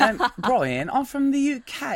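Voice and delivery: high, mock British accent. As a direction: put on a lively British accent. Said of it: "other Koreans that live abroad from those countries".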